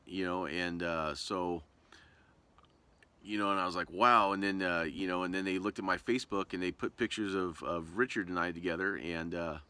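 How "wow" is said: a sudden, louder gasp of wow; breathless amazement; stunned wow. Retold or imitated. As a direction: let the surprise burst out. Reacting to the news.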